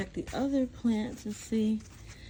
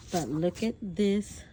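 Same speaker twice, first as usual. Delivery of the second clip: ordinary delivery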